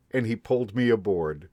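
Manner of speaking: ordinary delivery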